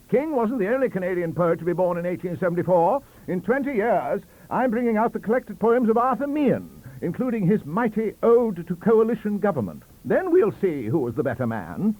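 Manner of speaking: loud, high challenge; shouting. Acted. Careless challenging Stacey, loud. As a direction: throw down the gauntlet. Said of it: "shouted Professor Careless".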